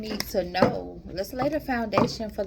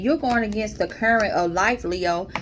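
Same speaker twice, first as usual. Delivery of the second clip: ordinary talk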